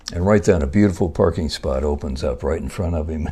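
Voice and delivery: ordinary delivery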